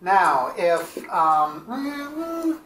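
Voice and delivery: usual speaking voice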